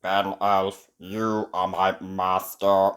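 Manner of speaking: low, slow robot monotone; toy robot. A narrator's reading. Robot waking to meet Ben, low robotic voice. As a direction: speak evenly like a machine.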